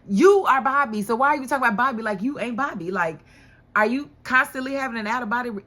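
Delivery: loud, punched first word, then fast; exasperated scolding. Real, not acted. Viewer scolding Bobby for third-person talk, loud exasperation.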